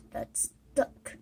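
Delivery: ordinary talk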